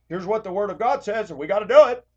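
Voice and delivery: mocking his old sermon voice; old preachy self. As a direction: ham up a by-the-book preacher. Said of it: "My videos would have been more like".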